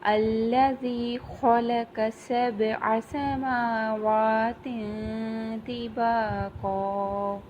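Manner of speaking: slow, drawn-out tajweed chant; measured Quranic recitation, long vowels. Shown as a demonstration. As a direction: recite slowly, stretching each vowel. Teacher recites verse.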